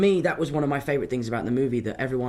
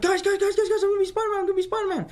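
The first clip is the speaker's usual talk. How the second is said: very high, breathless tumble of words; high-pitched, giddy acting-out; frantic excitement; much faster than usual. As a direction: burst in squealing the big news. Running downstairs to tell family.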